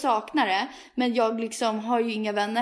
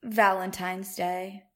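announcer flourish; 3 semitones lower, slower than usual. Acted. Grand announcement of topic.

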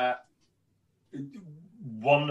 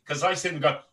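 normal talking voice

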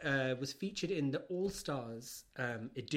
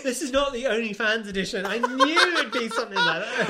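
higher, louder laughing scold; laughing mock scold; 8 semitones higher, 12 dB louder than usual. Real, not acted. Host laughing as she scolds co-host's joke. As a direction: tease him through laughter.

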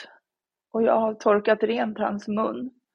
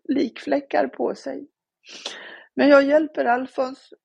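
higher, strained voice close to tears; holding back real tears as she tells it; voice breaking with grief; 6 semitones higher than usual. Real, not acted. Mother recounting finding her son dead, voice breaking with grief.

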